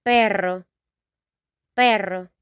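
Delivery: perro with a rolled rr, PEH-rro; Spanish perro. Shown as a demonstration. Teacher modeling dog in Spanish, rolled r. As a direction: roll the rr in perro. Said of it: "In Spanish we say".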